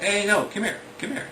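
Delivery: lightly voiced kid, urging; kid calling them back. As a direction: call a straggler back as a bossy little kid. Kid calls back a wandering Jim.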